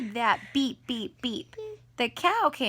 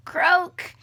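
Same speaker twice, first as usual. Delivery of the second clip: voiced toad croak, a little higher; 5 semitones higher, 4 dB louder than usual. A narrator's reading. Parent voicing the toad for a child, light croak. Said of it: "Toad said".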